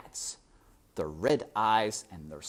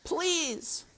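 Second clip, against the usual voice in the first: much higher plea; pleading shout. A narrator's reading. Despero shouts his plea, much higher. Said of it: "shouted Despero".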